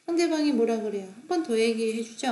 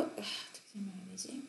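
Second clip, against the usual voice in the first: very soft, whispered aside; muttering to self; 3 semitones lower, 16 dB quieter than usual. Real, not acted. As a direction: think aloud under your breath. Thinking aloud how to explain.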